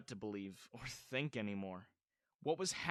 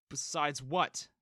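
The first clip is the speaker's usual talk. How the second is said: slow, louder sharp demand; snapped; 3 semitones higher, 6 dB louder, slower than usual. A narrator's reading. Son demanding stepmom finish her sentence, snapping. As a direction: snap the question back. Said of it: "I snapped".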